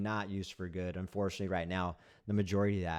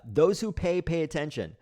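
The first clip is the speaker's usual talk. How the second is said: high, loud sneer at the saying; mocking quote; 6 semitones higher, 4 dB louder than usual. Reading out a hated saying with mock emphasis. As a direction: say the slogan as a mocking jab.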